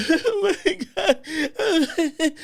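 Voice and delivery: very high, drawn-out mock wail; laughing mock sob; fake crying wail. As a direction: ham up a tearful coworker. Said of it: "folks that be crying at the job".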